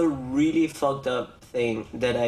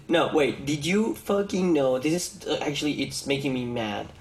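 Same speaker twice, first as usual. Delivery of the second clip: ordinary delivery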